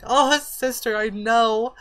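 high, loud, hammed-up diva gush; dramatic reply. Answering her friend about almost hitting 1K, hammed-up high loud gush. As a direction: belt it like an over-the-top bestie. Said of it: "And I'm like".

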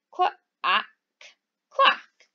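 ordinary delivery

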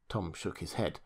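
ordinary delivery, nothing marked

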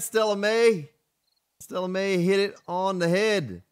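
usual speaking voice

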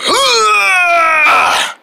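long, high straining groan; lifting grunt. Pretend. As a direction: heave with a drawn-out groan. Group heaves on go.

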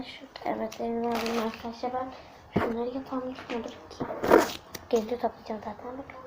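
hushed aside; whisper. Real, not acted. Girl whispering while gathering the papers. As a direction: keep it to a whisper.

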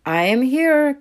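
high, loud duckling reply; calling out. A narrator's reading. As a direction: call back loudly from hiding. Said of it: "called Puddle".

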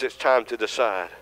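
ordinary delivery

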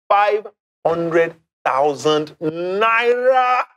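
slow, stretched hype on the big number; milk the prize like a game show host; drawn-out prize announcement. Acted.

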